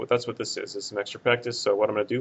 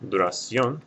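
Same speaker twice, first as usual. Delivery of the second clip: Duración stressed on the final CIÓN; stress on last syllable; slower than usual. Shown as a demonstration. Teacher explaining the accent over the O, Duración stressed on CIÓN. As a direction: lift and lean on CIÓN in Duración. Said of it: "the stress is over the last syllable".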